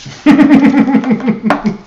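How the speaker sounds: high, bursting laugh at herself; real laugh. Real, not acted. Reader laughing at her own obvious message, high burst. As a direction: let a high laugh break out.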